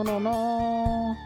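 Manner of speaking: ordinary talk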